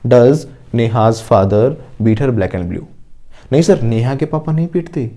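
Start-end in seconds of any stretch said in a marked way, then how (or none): 0.1-2.8 very slow, word by word, for students to repeat; slow model sentence. Shown as a demonstration. Teacher modelling an answer for the class, very slowly. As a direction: spell each word out slowly like a dictation. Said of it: "फिर से बोलो साथ साथ".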